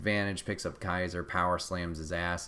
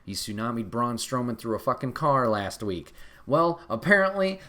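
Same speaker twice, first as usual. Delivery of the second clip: ordinary talk, nothing marked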